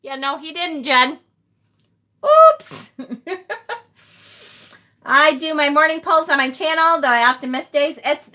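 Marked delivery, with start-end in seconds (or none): 2.2-2.9 oops. Real, not acted. Realizing she made a mistake. 3.0-3.8 giggle. Real, not acted. Laughing at own mistake.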